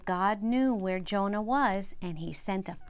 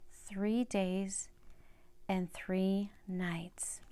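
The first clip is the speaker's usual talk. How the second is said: hushed scripture; 3 semitones lower, 6 dB quieter, much slower than usual. A narrator's reading. Scripture read slowly and hushed.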